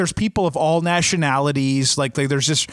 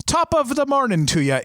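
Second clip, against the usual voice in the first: higher-pitched, put-on Irish lilt; bad Irish accent; 8 semitones higher than usual. Shown as a demonstration. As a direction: toss out a hokey Irish accent. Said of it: "in your worst Irish accent".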